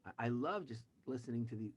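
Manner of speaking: usual speaking voice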